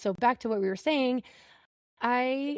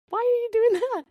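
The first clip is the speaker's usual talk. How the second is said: much higher, laughing through the question; laughing disbelief; 14 semitones higher, 4 dB louder than usual. Retold or imitated. Mom questioning the doctor, high laughing disbelief. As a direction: laugh at the absurd idea while asking. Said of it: "And I said".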